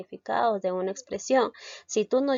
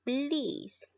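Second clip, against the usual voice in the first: "blis" alone, pitched up and softer; single out the syllable blis, held and raised; isolated stressed syllable; 5 semitones higher, 6 dB quieter than usual. Shown as a demonstration. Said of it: "recae en la sílaba".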